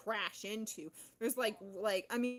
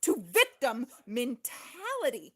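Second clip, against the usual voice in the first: loud declaration; 4 semitones higher, 11 dB louder than usual. Real, not acted. Loud declaration after outburst.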